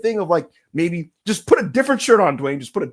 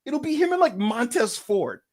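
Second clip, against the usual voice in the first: normal talking voice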